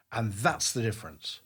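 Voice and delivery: usual speaking voice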